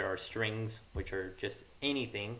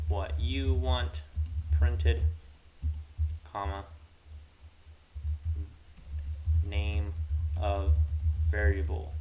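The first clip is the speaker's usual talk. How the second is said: dictating while typing; 6 dB louder, slower than usual. Real, not acted. Dictating words while typing them.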